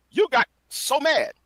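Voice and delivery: high, slow mocking sing-song; mocking tease. Real, not acted. Taunting his old rival for getting angry, high mocking tease. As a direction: rub it in that he got mad. Teasing the other after argument.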